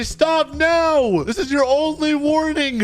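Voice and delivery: high, urgent command to halt; shouted police warning. Pretend. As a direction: play a cop yelling at a fleeing suspect. Police order during chase.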